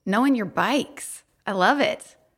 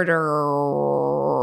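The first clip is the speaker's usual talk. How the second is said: gritted teeth; 5 semitones lower than usual. Retold or imitated. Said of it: "Through gritted teeth".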